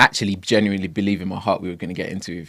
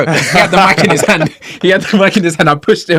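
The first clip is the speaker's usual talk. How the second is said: higher, louder, laughing through it; laughing excitedly; 8 semitones higher, 10 dB louder than usual. Real, not acted. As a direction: giggle while retelling the rush.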